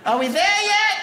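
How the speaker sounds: put-on high whiny kid. Kid in the back seat nagging his parents, high whine. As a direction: whine it like a bored little kid.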